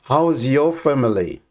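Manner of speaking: usual speaking voice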